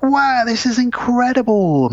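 high, slow hammed-up awe; family wow. Host voicing family at bonus news, high gushing. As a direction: gush like the amazed family. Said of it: "they're all like".

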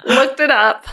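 loud, high, laughing through words; excited laughing. Real, not acted. Host thrilled about her research, laughing excitedly. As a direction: giggle with real delight. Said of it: "So excited".

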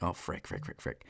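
hushed, frantic muttered panic; panicked whisper. Retold or imitated. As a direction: whisper it like a scared kid who messed up. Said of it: "I'm like".

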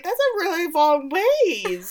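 high, loud, drawn-out mock disbelief; incredulous. Viewer heckling the rowing plan, incredulous. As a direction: balk at the distance, stretching it out. Retelling her incredulous reaction, joking.

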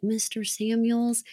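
put-on soft, slow, earnest boyish voice; small, softened voice of an earnest boy; innocent little boy. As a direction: imitate an innocent little boy, hushed and drawn out. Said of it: "that really innocent voice be like".

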